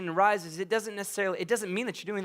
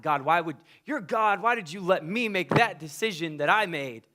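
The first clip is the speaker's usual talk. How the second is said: ordinary talk, nothing marked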